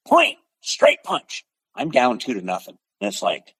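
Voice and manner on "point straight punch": referee call. Retold or imitated. Referee calling the point again.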